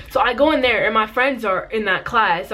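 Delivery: ordinary talk, nothing marked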